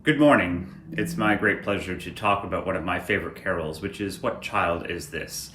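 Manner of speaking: ordinary talk, nothing marked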